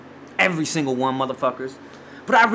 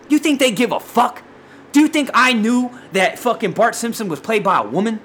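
higher, worked-up disbelief; heated rant; 6 semitones higher than usual. Real, not acted. Podcaster ranting about celebrities' cartoon apologies, heated. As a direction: fire off indignant questions in a raised pitch. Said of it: "I'm gonna have to simmer down now".

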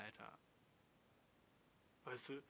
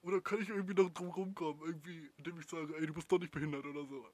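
higher, louder slacker voice; host imitating the viewer as a bro, higher and louder; mock dude; 5 semitones higher, 6 dB louder than usual. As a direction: parody a careless bro. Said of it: "Aber wenn du sagst".

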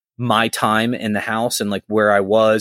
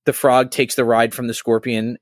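ordinary talk, nothing marked

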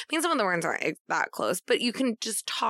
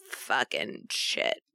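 muttered swear; 11 semitones lower, 3 dB quieter, much slower than usual. Real, not acted. Frustrated self-correction mid-sentence.

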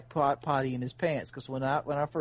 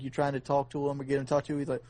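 ordinary talk

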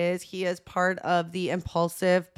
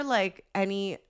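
ordinary talk

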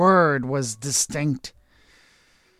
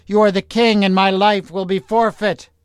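high, loud panicked accusation; panic, she's been tricked; 6 semitones higher, 5 dB louder than usual. A narrator's reading. Witch panics at recognizing king.

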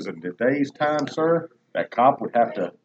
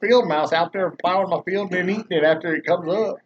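loud, higher boast; mock boast; 5 semitones higher, 5 dB louder than usual. Real, not acted. Bragging to his cohost about his mice, loud. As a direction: brag it loud like it's obvious.

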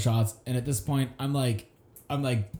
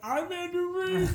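very high, drawn-out mock wail; hammed-up tearful cry, half laughing; slower than usual. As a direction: squeal it out as if bursting into tears. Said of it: "like, crying".

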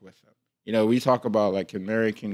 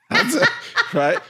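laughing; 4 dB louder than usual. Real, not acted.

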